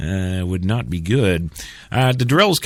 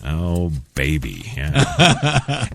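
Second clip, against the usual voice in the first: sultry moan; 5 semitones lower, much slower than usual. Pretend. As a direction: drawl it seductively. Repeats sultry ad tag.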